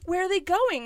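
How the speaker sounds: high forced-casual question; fake casual. Mother asking office staff, high forced casual. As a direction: ask lightly, hiding panic.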